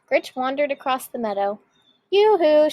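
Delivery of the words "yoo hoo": high, loud sing-song call; calling shout. A narrator's reading. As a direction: call out across the meadow. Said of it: "she shouted".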